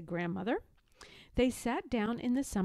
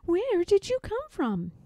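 much higher, gentle question; curious little cub; 11 semitones higher, 3 dB louder than usual. A narrator's reading. Cub asking baby robin.

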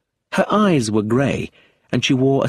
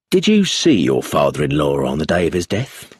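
lower-voiced inspector, probing; questioning police inspector; 5 semitones lower than usual. A narrator's reading. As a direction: question her in a lower, firm voice.

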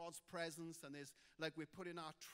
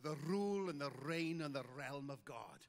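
put-on Welsh preacher accent; 4 semitones lower, 4 dB louder than usual. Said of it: "it just sounds anointed when you say it".

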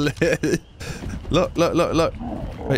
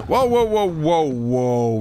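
rapid whoas starting high and loud, then sinking; alarmed whoa; 3 semitones higher, 3 dB louder, slower than usual. Real, not acted. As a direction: call out to rein in a mount. Calling to halt the mount Ryan.

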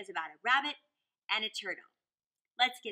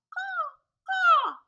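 very high, very loud crow caw; loudest caw; 13 dB louder than usual. Acted. Crow calling out to be queen, loud caw. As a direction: scream it out like a proud crow. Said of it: "to utter the loudest caw".

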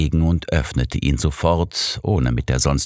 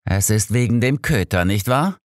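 exasperated, higher question; fed up with the dog; mildly irritated retort; annoyed; 7 semitones higher than usual. A narrator's reading. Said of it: "erwiderte Holmes genervt".

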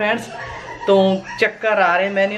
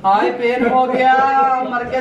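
hammed-up whine about the pain, a bit higher; mock whining husband; 5 semitones higher, 3 dB louder than usual. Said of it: "साहब भी ना बच्चों जैसे हरकतें करते हैं". (बच्चों जैसा नाटकीय कराहना)

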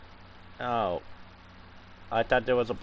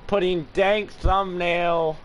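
slow loud hype; 9 semitones higher, 6 dB louder, much slower than usual. Real, not acted.

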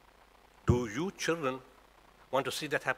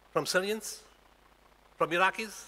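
ordinary talk

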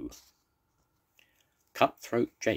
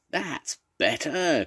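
slow, much higher cry of relief; pleased cry; 10 semitones higher, slower than usual. Acted. Captain pleased with his crew's singing, slow higher cry. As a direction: praise the crew with delight. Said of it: "cried Captain Pugwash".

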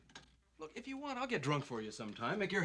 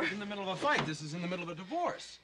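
high, loud, agitated protest; hysterical; 6 semitones higher, 4 dB louder than usual. Acted. Husband stops wife going to bed, loud and hysterical. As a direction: insist on the crisis, voice climbing. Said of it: "you're hysterical".

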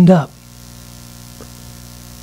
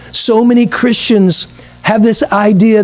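ordinary talk

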